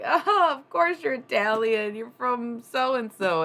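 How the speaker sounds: loud, high, matter-of-fact boss impression; imitating her boss. Boss telling her she's obviously Italian, loud imitation. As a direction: boom it out like the obvious was obvious. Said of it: "a boss of mine was like".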